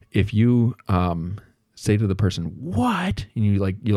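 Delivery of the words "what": mock outraged disbelief. Reacting to a colleague's embezzlement news, mock outrage. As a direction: act out losing your temper, pitch jumping up. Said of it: "like, lose your temper".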